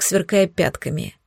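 plain reading voice

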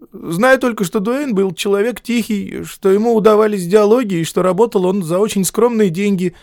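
put-on high, tense young man's voice; nervous young editor. A narrator's reading. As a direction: voice him as a jittery clerk.

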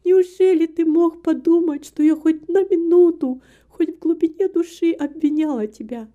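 high, loud, emotional protest; reproachful exclamation. A narrator's reading. Woman denies blaming her beloved, with heartfelt reproach. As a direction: reproach him for ever doubting. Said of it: "с упрёком воскликнула Эмилия".

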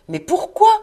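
high, loud cry of disbelief; exclaiming. A narrator's reading. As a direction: cry it out, shooting up in pitch. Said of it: "s'écria son neveu". (exclamation étonnée, très aiguë)

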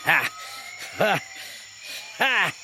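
scornful ha ha ha. Acted. Cornelius's scornful single laughs.

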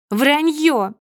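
loud, high outburst; angry denial. A narrator's reading. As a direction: hurl the accusation back at him. Wife flies at him, contradicting. (гневный громкий выкрик)

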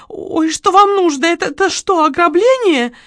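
put-on high, alarmed shop girl; scared, halting question; frightened cry. A narrator's reading. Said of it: "вскрикнула продавщица".

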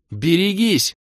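high, urgent cry; shouted warning. A narrator's reading. Кира warns the party, crying out. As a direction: call out the danger at full voice. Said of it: "в голос крикнула Кира".

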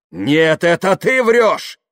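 high, drawn-out shout; angry retort. Acted. Kaftanov turning the lie charge on Yuklin, angry retort. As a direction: hurl the accusation back. Kaftanov throws accusation back.

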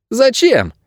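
put-on high child's voice, demanding; agitated boy. A narrator's reading. Boy challenging Найрод's claim to protect the estate, agitated. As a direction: demand a reason in a high boy's voice.

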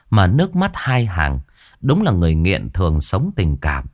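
plain reading voice

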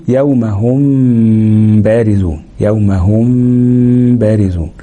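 slow recitation, م of هم held as a long nasal hum before بارزون; labial ikhfa. Shown as a demonstration. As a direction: hide the م of هم in a drawn-out, level ghunna, then release into the ب of بارزون. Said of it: "فسمينا الإخفاء بتاع الميم الساكنة إخفاء شفوي".

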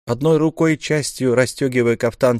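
ordinary talk, nothing marked

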